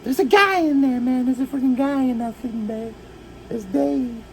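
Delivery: high-pitched frantic exclaiming; acted alarm. Kid discovering Dave in the sleeping bag, mock alarm. Acting out reaction to Dave freaking out.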